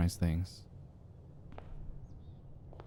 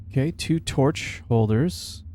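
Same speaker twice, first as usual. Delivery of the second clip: ordinary talk, nothing marked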